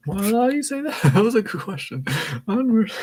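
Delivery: high, giggly outburst; laughing. Real, not acted. Reader teased over an odd remark, high and laughing.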